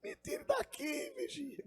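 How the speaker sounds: thin squeaky plea, drawn out; hammed-up tiny falsetto call for help; mock cry, falsetto. Cowboy begging the watchman at the alligator tank, mock falsetto cry. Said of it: "ele gritou".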